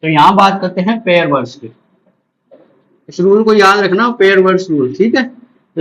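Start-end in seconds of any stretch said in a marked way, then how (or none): none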